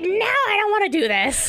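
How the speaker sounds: put-on a child pushing away the puzzle; whiny refusal. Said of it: "she's like".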